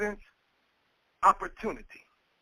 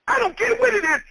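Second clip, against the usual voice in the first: high emphatic repeat; 9 semitones higher, faster than usual. Acted. Preacher repeats it, much higher.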